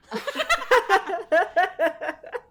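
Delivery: high falling burst of laughter; cracking up at herself; big laugh. Real, not acted. Host laughing at her own crude word, big high laugh.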